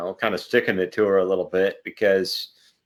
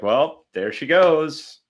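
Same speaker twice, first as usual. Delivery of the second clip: drawn-out mock-cheerful resignation, higher pitch; sarcastically happy; 5 semitones higher, much slower than usual. Imitating the jilted singer seeing her go, sarcastically happy. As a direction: stretch it out with sarcastic good cheer. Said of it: "sarcastically happy, though, in the sense that he's like".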